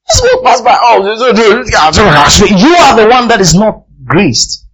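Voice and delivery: high, forceful preaching outburst; loud exclamation. Real, not acted. Preacher exclaims at those who overlook grace.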